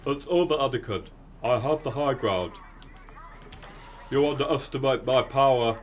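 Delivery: Obi-Wan done as a clueless British salesman; Obi-Wan as Brian Butterfield. Retold or imitated. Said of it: "Obi-Wan impression ... as Brian Butterfield".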